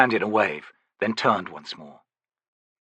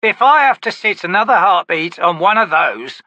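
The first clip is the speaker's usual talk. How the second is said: gruff, louder, higher grumble; growling soldier; 9 semitones higher, 7 dB louder than usual. Acted. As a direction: complain like a sore grunt. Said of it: "a voice growled".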